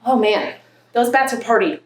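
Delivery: ordinary delivery, nothing marked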